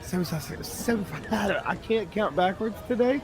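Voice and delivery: high, fumbling countdown voice; playing the character botching the countdown; flustered countdown. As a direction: lose count and get flustered.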